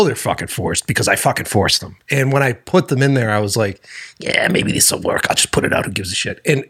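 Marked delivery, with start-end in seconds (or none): 4.2-6.4 low, voiceless shrug of a thought; dismissive self-talk. Retold or imitated. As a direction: toss it off under the breath. Retells own shrugging inner thought.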